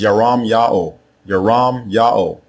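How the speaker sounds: Yaram Yao as yah-RAHM YAH-oh; correct pronunciation. Shown as a demonstration. Teacher shows how to say the prophet's name, Yaram Yao as yah-RAHM YAH-oh. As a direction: sound out Yaram Yao with open ah vowels. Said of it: "The way you say his name is".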